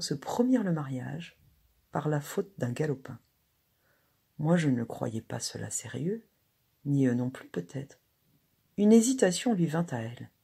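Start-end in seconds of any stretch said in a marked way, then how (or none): none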